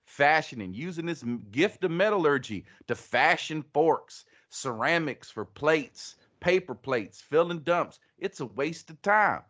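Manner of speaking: hammed-up Southern pitchman; Tex Pablo. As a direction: preach it like a twangy salesman. Tex Pablo's mock sales rant.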